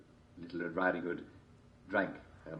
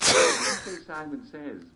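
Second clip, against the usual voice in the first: game host call; 8 semitones higher, 7 dB louder, much slower than usual. Acted. Game host calling out commands.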